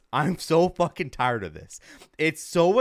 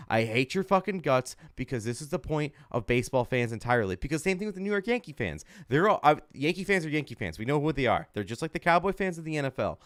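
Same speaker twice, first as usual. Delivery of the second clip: ordinary talk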